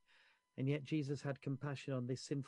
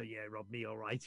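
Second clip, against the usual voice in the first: quieter, muttered sarcastic grumble; sarcastic crowd murmur; 5 semitones lower, 7 dB quieter than usual. Retold or imitated. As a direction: grumble it under your breath like a resentful crowd. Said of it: "you can almost hear the murmur".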